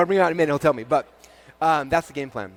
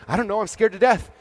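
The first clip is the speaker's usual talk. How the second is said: normal talking voice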